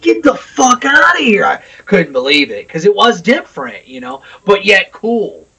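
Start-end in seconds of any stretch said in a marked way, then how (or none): 0.0-1.5 disbelief. Retold or imitated. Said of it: "I'm like".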